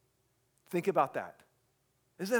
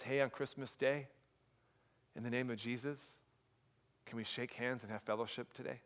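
quiet hopeful plea; 3 semitones lower, 10 dB quieter than usual. Retold or imitated. Preacher voices brave soldier's call.

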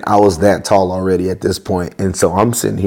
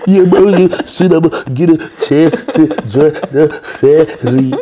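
mock crying; 8 semitones higher, 4 dB louder than usual. Retelling crying in old man's arms.

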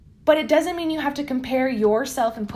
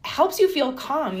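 usual speaking voice

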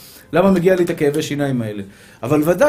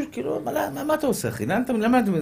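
high, soft, griping voice; mock exasperated driver; 6 semitones higher, 6 dB quieter, faster than usual. Man in traffic questions a honking driver, high soft exasperation. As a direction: complain high and under your breath.

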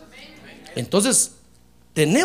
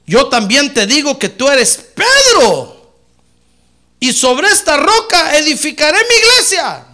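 slow, loud, higher proclamation; voice of the Lord; 8 semitones higher, 10 dB louder than usual. Acted. The Lord declaring to Peter, loud and solemn. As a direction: pronounce it like a solemn decree. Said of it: "el Señor le dijo".

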